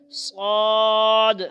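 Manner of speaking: صاد drawn out very slowly, loud and heavy; slow loud thick صاد; thick Saad. Shown as a demonstration. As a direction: make صاد fat and full. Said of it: "موٹا ہے، آواز موٹی ہوگی".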